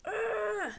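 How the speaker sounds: a high strained grunt of attack; sharp pained cry as the raiders strike. Acted. Sand people attack.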